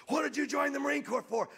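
high, loud drill sergeant bark; drill sergeant yelling. Retold or imitated. Drill sergeant yelling at a recruit, high loud bark. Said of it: "the drill sergeant is screaming".

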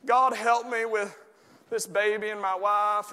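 put-on a light southern accent on a whined prayer; mock southern prayer, lightly done. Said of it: "There's a southern accent again".

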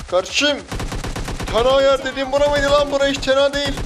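very high, very loud outcry; yelled protest. Real, not acted. Gamer protesting the exposed spot, yelled. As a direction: scream your complaint at a teammate. Yelling protest while under fire.

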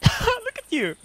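put-on squeaky high baby talk with a laugh; cutesy cooing. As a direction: fuss over the duck in falsetto. Cooing at the duck.

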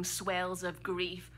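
slow, howling wail of grief; mock howling sob. Storyteller sobbing mid-sex with Emily, hammed-up slow howl. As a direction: wail it out like a dying animal. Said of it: "that's exactly how it sounded".

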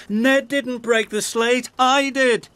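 breathless high confession; out of breath. Acted. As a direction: gasp it out after racing. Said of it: "called Jack out of breath".

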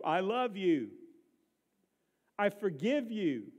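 building, driving sermon delivery; loud, urgent pulpit plea, easing at the end; impassioned preaching. Real, not acted. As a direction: press the appeal home with rising force. Preaches at full force.

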